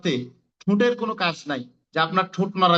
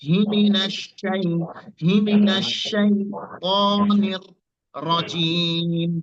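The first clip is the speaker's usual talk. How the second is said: slow chant stopping and repeating shy-; Arabic recitation, very slow; recitation with stops; much slower than usual. Shown as a demonstration. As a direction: break the recitation to show শাইতান. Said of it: "যেমন ধরেন".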